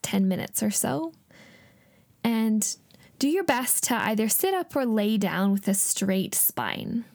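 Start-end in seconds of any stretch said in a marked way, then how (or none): 2.2-7.0 calm meditative tone. Acted. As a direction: guide them gently into calm, half-whispered. Leads a guided meditation.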